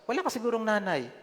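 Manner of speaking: high-pitched scolding mother; angry mother. Retold or imitated. Classmate's mother scolding the boy, high-pitched angry rebuke. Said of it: "galit na galit, sabi niya".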